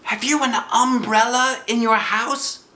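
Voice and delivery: high, bright bear voice calling out; Pooh's cheerful cry. Acted. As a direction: call like a happy little bear. Pooh voiced.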